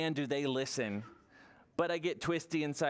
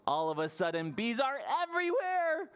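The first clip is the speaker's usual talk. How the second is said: plain reading voice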